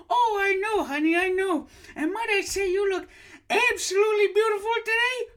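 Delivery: very high, loud, gushing granny voice; a shrieking delighted old woman; high old lady voice. Acted. Host in falsetto as psychic, gushing shrill greeting. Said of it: "doing the voice".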